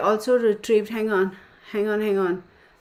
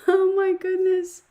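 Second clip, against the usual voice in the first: high, drawn-out laughing exclamation; high-pitched, tickled reaction; amused disbelief; 11 semitones higher than usual. Real, not acted. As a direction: squeal it slowly through your amusement. Laughing after reading a funny message.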